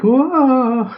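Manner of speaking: sudden high whoa; startled cry. Retold or imitated. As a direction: get blown back in surprise. Doctor startled.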